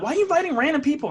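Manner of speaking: high, loud, fast girlfriend impression; annoyed girlfriend. Girlfriend scolding her drunk boyfriend, high-pitched mock exasperation. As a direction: imitate her scolding him at speed.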